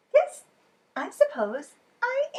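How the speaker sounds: high, bright burst of pride; proud delight. Acted. Duck accepts champion title, proud and delighted. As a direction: puff up and beam it out.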